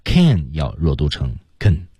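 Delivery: "can" reduced to a short "kn"; weak can. Shown as a demonstration. Teacher showing weak form of can, clipped. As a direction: swallow the vowel of "can".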